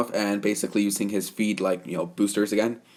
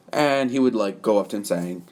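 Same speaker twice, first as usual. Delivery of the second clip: plain reading voice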